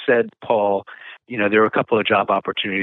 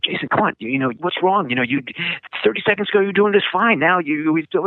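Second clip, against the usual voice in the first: higher, urgent coaxing; coaxing instructor; 8 semitones higher than usual. Retold or imitated. Head instructor urging a frozen student, higher urgent coaxing. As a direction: plead with him to take the wheel again.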